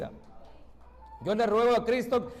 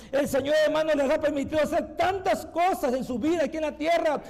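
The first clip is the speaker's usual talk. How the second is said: forceful, urgent church exhortation; driving sermon delivery in a full, raised voice; impassioned preaching; 5 semitones higher than usual. Acted. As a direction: press the congregation with fervent reproach.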